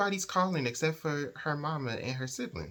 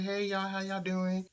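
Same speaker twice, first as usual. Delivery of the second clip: high, drawn-out singsong hello; slow, high-pitched mock-friendly greeting; cheery greeting; 7 semitones higher than usual. [name] greeting the pair for the morning run, cheery and high.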